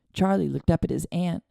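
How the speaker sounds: ordinary delivery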